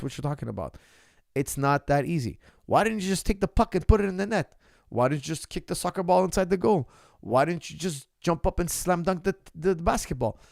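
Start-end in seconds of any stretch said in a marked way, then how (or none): 2.6-10.4 higher, louder mocking whine; mock armchair critic. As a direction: mimic a fan who thinks it's easy.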